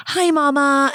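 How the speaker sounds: high mock greeting from a group mom; imitating group moms. Mom mimicking her mom group's hello, high imitation. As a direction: lift the pitch into a put-on mom hello. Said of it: "moms in my mom group will just be like".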